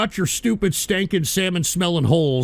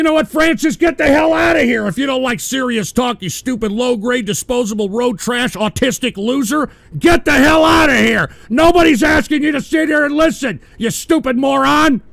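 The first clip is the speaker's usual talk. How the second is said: angry yelling; 3 semitones higher than usual. Real, not acted. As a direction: scream him out of the room. Yelling at a heckler named Francis.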